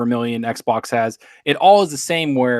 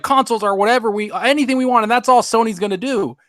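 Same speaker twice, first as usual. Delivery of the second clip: higher-pitched imitation of fanboys; mock fanboy; 8 semitones higher than usual. Podcaster quoting console fans to knock them down, mocking. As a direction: parrot their claim in a raised pitch.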